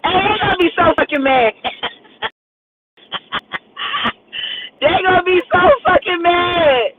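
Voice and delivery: giggling high through the words, pitch way up; can barely get it out for laughing; cracking up at his own joke. Real, not acted. Laughing at own prank idea.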